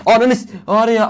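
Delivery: tiz, yüksek sesle aniden bağırarak küfret; sahte öfke patlaması. Acted. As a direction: oyunu kıran birine sahte sinirle patla. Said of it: "Çok ani değiştim değil mi?". (izleyicilere şaka yollu ani öfke çığlığı)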